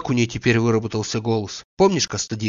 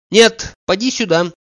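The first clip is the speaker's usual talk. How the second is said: higher, louder summons; loud call; 8 semitones higher, 5 dB louder than usual. A narrator's reading. Oblomov calls Zakhar back to share the news, loudly. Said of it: "громко сказал он".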